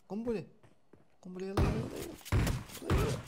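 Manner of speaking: high urgent shout of grenade; grenade warning yell. Real, not acted. Grenade thrown during a firefight.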